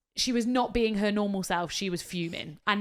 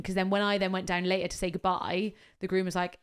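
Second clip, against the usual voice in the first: ordinary delivery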